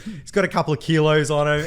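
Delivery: ordinary talk, nothing marked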